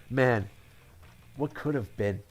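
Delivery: usual speaking voice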